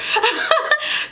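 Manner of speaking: high-pitched burst of laughter; amused laugh. Real, not acted. Wife laughing at how her husband defended her, amused. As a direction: crack up after the quote, high.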